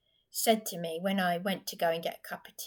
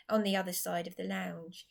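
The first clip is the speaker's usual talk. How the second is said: normal talking voice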